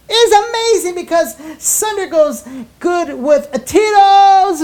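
slow, loud hype announcement; hype shout. Acted. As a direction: blast it like an announcer. Hype sponsor reveal.

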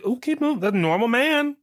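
high, put-on voice of an unbothered bystander; mock shrugging acceptance. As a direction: imitate someone waving off the absurd. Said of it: "people are just gonna shrug, like".

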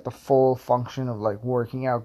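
ordinary delivery

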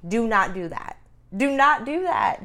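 insist, stressing not; emphatic warning. Real, not acted. Warning against rushing voting.

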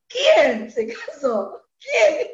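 sorpresa. Retold or imitated. Noticia inesperada de la boda.

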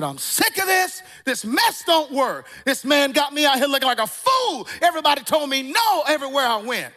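high-pitched retold exasperation; frustrated outburst. Young job-seeker giving up on the advice, high frustrated rant. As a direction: act out being completely fed up. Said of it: "I got frustrated".

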